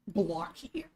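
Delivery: ordinary delivery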